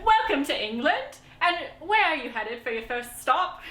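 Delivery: put-on British customs officer; playful British officer impression; British accent. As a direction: welcome someone in an imitated British accent. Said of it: "Can I do my British accent?".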